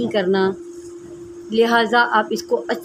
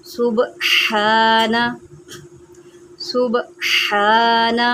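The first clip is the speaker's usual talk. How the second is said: slow recitation; much slower than usual. Shown as a demonstration. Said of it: "اب یہاں پر را کو موٹا کریں".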